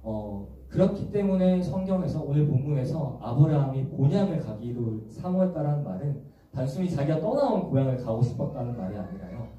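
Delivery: normal talking voice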